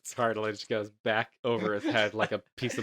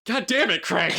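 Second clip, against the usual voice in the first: high mock-angry outburst; exasperated; 7 semitones higher, 3 dB louder than usual. Doctor fed up with forgetful Craig, exasperated. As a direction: curse him out, pitched high.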